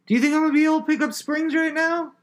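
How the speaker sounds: high incredulous squeak; squeaky high voice. Real, not acted. Protesting to a friend about picking up springs, squeaky high voice. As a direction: push the question up into a squeak. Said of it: "your voice can get three octaves higher".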